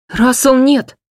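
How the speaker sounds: high loud alarmed cry. Acted. Mother crying out to her husband, high and loud. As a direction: scream for him to stop. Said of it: "вскрикнула мама".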